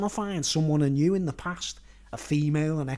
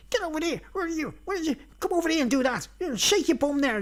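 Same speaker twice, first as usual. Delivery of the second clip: high-pitched imitation of a bossy leprechaun; rude leprechaun; 10 semitones higher, faster than usual. Leprechaun ordering a woman about, mimicked high and rude. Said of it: "being really rude to her and everything".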